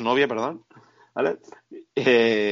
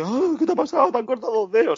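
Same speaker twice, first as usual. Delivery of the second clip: mock worried hero; 10 semitones higher than usual. Mocking the other character.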